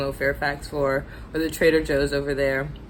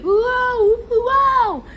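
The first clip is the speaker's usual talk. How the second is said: high, loud excited whoa; gasping in amazement; 6 dB louder, much slower than usual. Retold or imitated. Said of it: "everyone was like".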